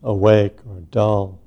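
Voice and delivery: unhurried meditation guidance with long pauses; guided meditation. Real, not acted. Teacher settling a group into sitting meditation, unhurried guidance. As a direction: ease each phrase out, leaving space after it.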